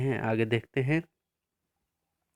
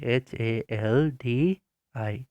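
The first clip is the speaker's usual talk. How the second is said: slow, spaced-out letters for copying; slow dictation spelling; much slower than usual. Shown as a demonstration. Teacher dictating spelling slowly to pupils. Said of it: "फिर से वही लिखेंगे".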